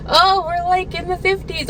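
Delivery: high-pitched, mocking singsong quote; mock breezy weather. Mimics the weather's earlier mildness.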